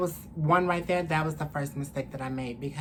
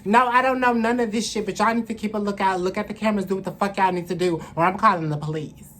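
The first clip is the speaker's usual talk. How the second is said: retold angry warning; 4 semitones higher, 8 dB louder, faster than usual. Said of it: "And I'm like".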